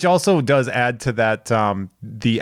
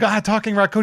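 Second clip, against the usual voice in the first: startled; 10 semitones higher than usual. Retold or imitated. Actor panics at raccoon.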